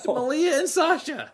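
high, laughing mock-exotic Malia and Sasha; mock accent. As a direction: parody the pastor's odd accent. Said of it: "Was that an accent he was doing?".

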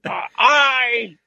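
high, strained mock senator croaking aye; lightly hammed corpse senator saying aye; mock Mike Lee. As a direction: play a half-dead senator forced to vote. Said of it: "Mike Lee".

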